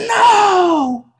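very high, long mock shout; hammed-up hotheaded teacher rejecting an answer; imitated yelling. Teacher rejects dancer's answer, imitated long loud yell. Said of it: "He just kept yelling".

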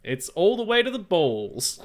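ordinary talk

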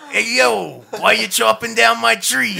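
mock Boston accent, a blue alien scolding a logger. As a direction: play a Boston tough guy yelling about his tree. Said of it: "they're all talking in a Boston accent".

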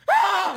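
high, loud yelp of a scream; imitated ugly scream. Showing friends his pal's ugly scream, imitated shriek. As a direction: mimic his awful shriek, sudden and loud. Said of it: "Hizo así el grito".

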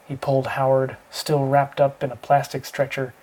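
plain reading voice

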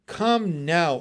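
loud, firm order; commanding shout. A narrator's reading. Frog mother shouting a commanding order to worried froglets. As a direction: command the children to obey. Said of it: "I shouted in a tone so commanding".

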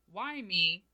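a small, hushed moping question; self-pitying whine. Retold or imitated. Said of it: "instead of asking myself".

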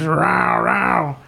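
mock roar from a song; imitated rap growl-word. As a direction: mimic a rapper's "rawr rawr". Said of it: "he goes in the song".

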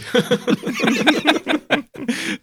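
high burst of laughter. Real, not acted. Big laugh after the joke.

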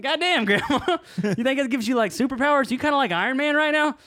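high-pitched teasing wonder, laughing; mock amazement. Grandson teases grandmother about her life vest, mock amazement.

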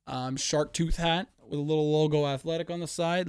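ordinary talk, nothing marked

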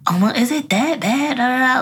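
lightly voiced mother asking; mock mother. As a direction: imitate mom brushing it off.

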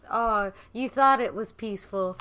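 normal talking voice

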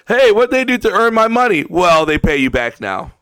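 ordinary delivery

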